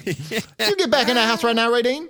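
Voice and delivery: laughing mock Southern mama calling her boy; Southern mom yell. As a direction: holler him back inside, laughing. Southern mom yelling at son.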